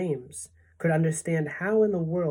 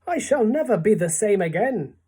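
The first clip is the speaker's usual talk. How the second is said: ordinary delivery, nothing marked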